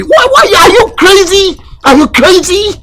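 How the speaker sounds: high-pitched indignant questioning; outraged scolding. Acted. Uncle scolding a young relative, outraged.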